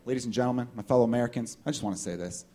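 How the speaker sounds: low, soft solemn address; candidate's podium speech. Acted. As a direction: open a speech gravely and quietly. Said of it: "guy or girl at the podium".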